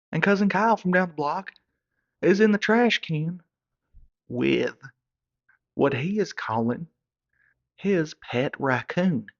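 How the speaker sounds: can't believe what he sees; incredulous. Real, not acted. Incredulous reveal.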